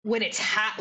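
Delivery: ordinary talk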